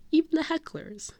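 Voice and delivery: high, sing-song concession; magnanimous aside. Real, not acted. Fan including those who heckled, high sweet aside. First take, voiced.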